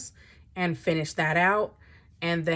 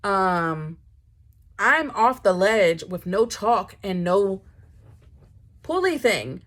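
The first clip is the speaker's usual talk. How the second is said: ordinary talk, nothing marked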